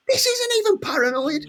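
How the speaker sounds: exclaiming in a high voice; high, excited quote. Retold or imitated. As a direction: pitch it way up in disbelief. [name] quoted mid-rampage.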